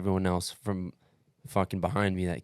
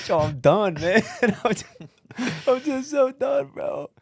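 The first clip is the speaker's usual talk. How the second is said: laughing whine; 7 dB louder than usual. Retold or imitated. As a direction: laugh through it as you whine how done you are. Laughing at his own breakdown.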